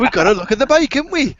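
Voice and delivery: very high, sing-song Welsh lilt; mock Welsh accent. Teasing a friend who sounded Welsh in his video, mock Welsh accent. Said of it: "you sounded Welsh in the video".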